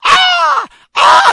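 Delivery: very high, very loud shrieking caws; bird squawk. Retold or imitated. Raven snatches the fish bowl, loud shrill squawking. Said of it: "He comes over and picks".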